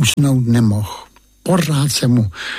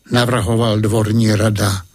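plain reading voice

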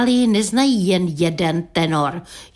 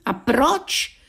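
higher-pitched, mildly surprised question; puzzled wonder; 5 semitones higher than usual. A narrator's reading. Countryman questioning the singing [name], mildly surprised. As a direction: ask it with a touch of naive wonder. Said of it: "podivil se venkovan".

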